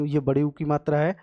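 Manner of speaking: ordinary talk, nothing marked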